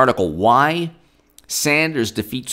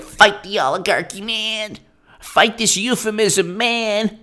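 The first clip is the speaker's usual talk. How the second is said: high, stoner-ish mocking voice, much higher; mock liberal; 10 semitones higher than usual. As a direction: parody a lazy activist drawling "man". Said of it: "Liberals will be like".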